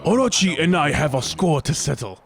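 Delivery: heroic declaration. Acted. Voicing Susano game dialogue.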